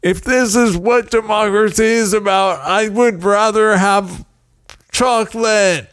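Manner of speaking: high, petulant begging for chocolate; whiny pleading. Retold or imitated. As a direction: beg for the chocolate like a whining child. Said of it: "it made me so upset".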